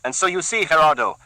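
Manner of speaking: a keen henchman keen to use the cleaver; Carlos, eager thug. Acted. Carlos offering his boss a fix, eager. Luis higher voice.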